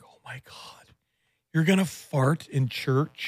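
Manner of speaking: hushed whisper in church. Retold or imitated. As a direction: whisper it like you're in a pew. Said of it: "You'd be like".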